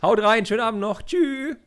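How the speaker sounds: high, loud sign-off; loud cheery goodbye. Real, not acted. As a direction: shout out a quick farewell.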